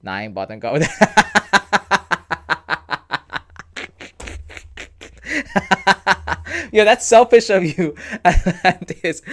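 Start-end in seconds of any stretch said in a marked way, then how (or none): 1.3-6.7 high, loud burst of laughter; cracking up at a funny comment; long real laugh. Real, not acted. Laughing at a viewer's joke. 6.7-9.2 talking through laughter, louder and higher; laughing. Real, not acted. Still laughing at the joke.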